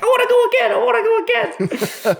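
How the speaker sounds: put-on very high squealed kid-like plea; hammed-up falsetto glee; giddy excitement. Retelling his glee after the ride.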